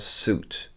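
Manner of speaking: "Suit" whispered, soot, no i; "Suit" soufflé, sans le i. Shown as a demonstration. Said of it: "Vous pouvez vraiment ignorer le i".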